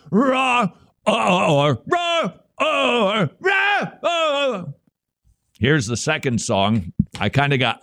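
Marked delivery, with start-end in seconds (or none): 0.1-4.6 shouted roar. Acted. Mock roar chorus.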